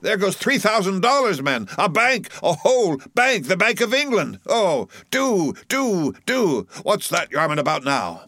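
high-pitched, frantic pleading with rowers; excited cry. A narrator's reading. Flask pleads with his lads to row harder, excited cry. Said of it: "cried Flask, dancing up and down".

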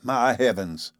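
ordinary delivery, nothing marked

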